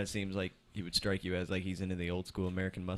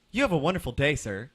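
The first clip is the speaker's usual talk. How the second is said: sugary sign-off, higher and louder; sarcastic polite farewell; 5 semitones higher, 7 dB louder than usual. Retold or imitated. Worker sending off the furious customer, sarcastic sweetness. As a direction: kill him with kindness.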